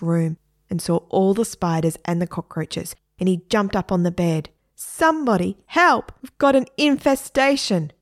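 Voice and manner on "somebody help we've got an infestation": high, loud cry for help; panicked cry. A narrator's reading. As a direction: yell from the bed in panic. Dad jumps on bed in panic.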